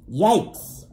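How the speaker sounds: usual speaking voice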